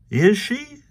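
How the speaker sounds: high squeaky-pitched questioning cat; Krazy Kat. A narrator's reading. Krazy asks the dog back, very high voice. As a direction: pipe it up high as a naive cat.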